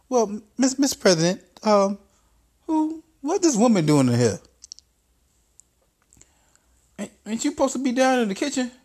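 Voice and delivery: high, fast mocking voice; high-pitched condescending boss; Trump character. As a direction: sneer it up high.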